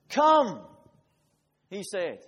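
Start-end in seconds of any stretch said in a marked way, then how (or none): 0.1-0.6 loud, higher call across the water; Jesus calling Peter out of the boat. Acted. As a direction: summon him boldly.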